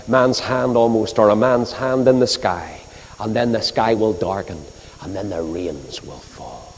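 normal talking voice

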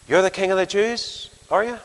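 incredulous question lifting at the end; faintly scornful, doubting question; touch of scorn. Acted. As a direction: size up a shabby prisoner in disbelief. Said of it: "there's a touch of scorn in it".

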